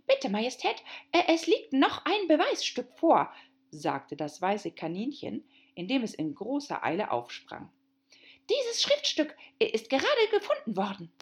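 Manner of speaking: high, hurried and stammering; a flustered little rabbit, tripping over words. Acted.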